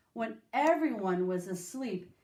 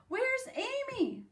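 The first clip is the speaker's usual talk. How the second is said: high, slow child voice, lightly voiced; a hint of a little brother asking; 14 semitones higher, slower than usual. A narrator's reading. Brother at the breakfast table asking after his sister, lightly high.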